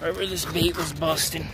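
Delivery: ordinary delivery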